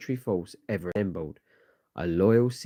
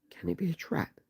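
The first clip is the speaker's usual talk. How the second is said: a much higher, thinner voice asking; worried high voice; 10 semitones higher, 7 dB quieter than usual. Acted. Nervous ally fearing an ambush, asking high and soft. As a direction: lift it way up, hushed and uneasy.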